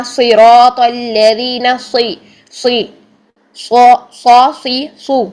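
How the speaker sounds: slow صراط الذین, then thick سی and سو; full-mouthed s in each سی، سو syllable; heavy saad drill. Shown as a demonstration. Teacher drilling saad from recitation, صراط slow and heavy. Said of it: "صاد کو بھی آپ دیکھ لیجئے".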